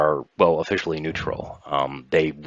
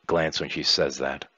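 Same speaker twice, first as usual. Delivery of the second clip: ordinary talk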